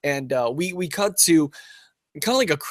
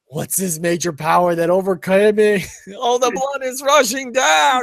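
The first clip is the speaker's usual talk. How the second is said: high, loud, hammed-up wail; mock overwhelmed cry; 8 semitones higher, 5 dB louder than usual. Acting a character overcome by attraction.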